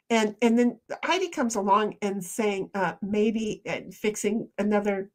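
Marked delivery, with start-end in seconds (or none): none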